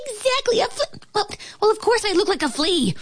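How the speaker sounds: high squeak tumbling into quick correction; agree, stumble, then rush out an indignant fix; flustered flea. A narrator's reading. Flea tricked by ant, high flustered squeak. Flea catches itself, annoyed.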